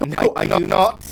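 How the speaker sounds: loud, high stubborn no; defiant refusal. Acted. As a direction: refuse loudly like a child. Creature refuses again.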